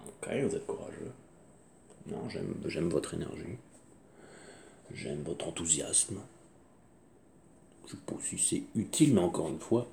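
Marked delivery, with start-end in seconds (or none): 0.1-6.2 soft teasing. Real, not acted. Softly teasing listeners.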